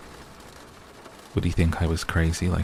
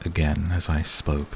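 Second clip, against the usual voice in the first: usual speaking voice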